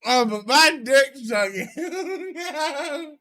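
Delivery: slow, laughing echo; drawn-out mocking laugh; laughing mockery. Mocking friend's jab.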